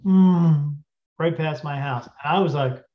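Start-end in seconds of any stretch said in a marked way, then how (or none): 0.0-0.8 loud hummed car whoosh; imitated car sound. Car goes past the house, imitated engine hum. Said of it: "a car went".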